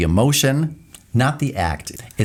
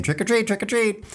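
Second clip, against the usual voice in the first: much higher, playful sing-song trick-or-treat call; trick-or-treater; 12 semitones higher, 3 dB quieter than usual. Pretend. At John's door on Halloween, a playful much higher trick-or-treat call. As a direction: chant it like a kid at the door. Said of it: "I'll be at your door saying".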